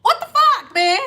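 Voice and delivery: high, exasperated cry; exasperated outburst. Real, not acted. Vlogger fed up with people ignoring her warnings, loud outburst. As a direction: throw your hands up in disbelief. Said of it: "Yes, I'm cussing".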